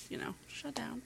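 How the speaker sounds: whisper. Real, not acted. Dramatic hushed aside.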